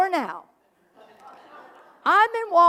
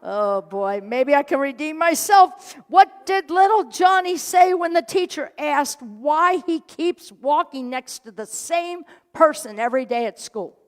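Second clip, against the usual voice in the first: ordinary talk, nothing marked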